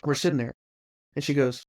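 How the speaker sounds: normal talking voice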